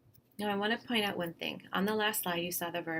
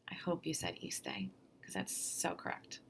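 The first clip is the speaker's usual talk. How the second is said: soft aside; 10 semitones lower, 8 dB quieter than usual. Real, not acted. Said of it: "teasing aside to students".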